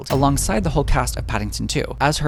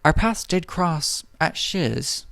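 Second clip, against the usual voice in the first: slow, prim good-witch voice; Galinda impression; 2 semitones higher, slower than usual. Galinda admitting her school past, slow and lofty. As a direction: say it slowly and grandly like a lofty witch. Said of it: "And Galinda's like".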